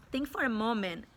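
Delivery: ordinary delivery, nothing marked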